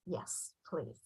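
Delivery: very soft, low answer; reply almost under your breath; quietly imitated parent's yes; hushed retold reply.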